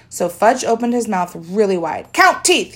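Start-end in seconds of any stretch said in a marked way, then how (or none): 2.1-2.8 put-on demanding toddler. Fudge demanding.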